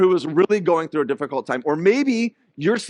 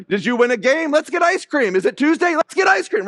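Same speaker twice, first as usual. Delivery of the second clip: high, loud eager treat offer; excited dad offer; 8 semitones higher, 3 dB louder than usual. Retold or imitated. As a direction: pitch it up like a thrilled dad. Listing reasons for ice cream.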